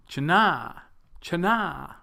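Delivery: China as chuh-NAH; weak first syllable, loud long NAH in China; wrong second-syllable stress. Shown as a demonstration. Teacher turning the stress round, China said chuh-NAH. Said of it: "which is wrong".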